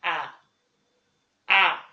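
"آ" like an alef with a fatha; short-a letter sound. Shown as a demonstration. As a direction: say "آ" as an open "ah". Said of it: "هقرا ال A إزاي؟".

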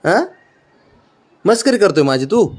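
usual speaking voice

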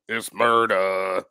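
slow low drawn-out murder. Retold or imitated. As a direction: stretch it like a rap hook. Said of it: "who's got a better [name]".

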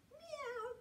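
high, soft meow; playful cat meow. Pretend. Teacher playing cat for children, playful high meow. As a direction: mew lightly like a cat.

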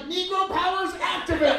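superhero activation. Pretend. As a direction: chant it like a cartoon hero powering up. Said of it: "I can't just be like".

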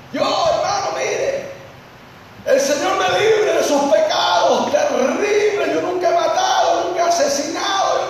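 high, indignant sister's voice; boastful church sister. Retold or imitated. Preacher imitating a sister boasting of her innocence. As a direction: play the proud sister swearing she never killed. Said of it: "una hermanita dijo".

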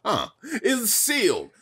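frantic NPC. Acted. Voicing frantic Toad dialogue.

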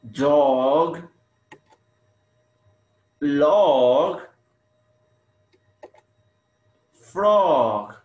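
dog, log, frog, each loud and drawn out; highlighted rhyme. Shown as a demonstration. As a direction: punch "Dog", "log", "frog" with long pauses between. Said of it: "Do you notice the similar sounds?".

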